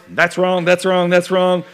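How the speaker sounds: mock HOA inspector, loudly ticking off faults; nitpicking mimicry. Pastor imitating the HOA on a drive-by, mock nitpicking. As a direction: rattle off the accusations, mildly mocking.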